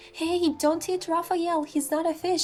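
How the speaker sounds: shooing shout. Real, not acted. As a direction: chase the birds off him. Rescuing him from pelicans.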